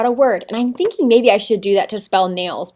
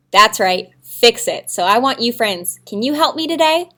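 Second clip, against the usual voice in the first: normal talking voice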